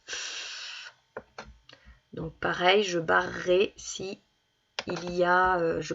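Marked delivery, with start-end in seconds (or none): 0.0-0.9 F as a long unvoiced hiss of breath, no vowel; just breathe out the F sound. Shown as a demonstration. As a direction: demo the sound F for children. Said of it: "lorsque j'entends le son".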